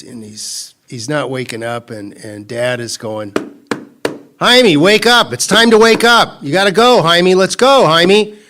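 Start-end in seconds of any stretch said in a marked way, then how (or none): 4.4-8.4 loud, high call to wake a sleeping son; dad calling out. Retold or imitated. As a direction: shout him out of bed, much higher and louder. Dad waking son in story.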